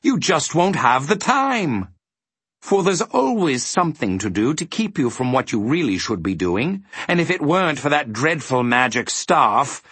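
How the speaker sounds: high, swooping villain lecture; scheming demon. A narrator's reading. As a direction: expound the wicked logic with relish. Figure of the demon Trivium.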